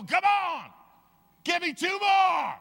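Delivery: high, loud barking coach shout; loud, high gym trainer shout; coach yelling. Retold or imitated. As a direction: yell it like a drill trainer pushing reps.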